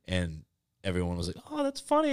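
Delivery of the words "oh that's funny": high, mock-polite "oh that's funny"; mock amused reaction. Everyone reacting to movie idea.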